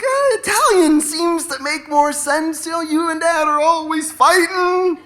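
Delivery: put-on high, drawn-out child's voice; arguing kid. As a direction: reason with mom in a high kid voice. Child argues back to mother.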